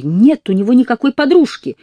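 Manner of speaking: high loud protest; shouting. Acted. Girl shouting defense.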